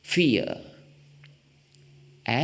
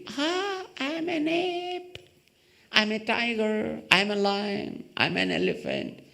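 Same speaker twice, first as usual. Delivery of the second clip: high, playful kid pretending to be animals; childlike play voice; 9 semitones higher than usual. Speaker imitating children in the wheel, high playful kid voice. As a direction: squeal it like a child playing zoo.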